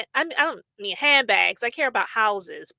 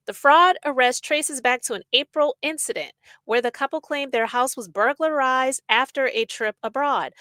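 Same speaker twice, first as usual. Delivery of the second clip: usual speaking voice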